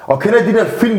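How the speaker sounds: usual speaking voice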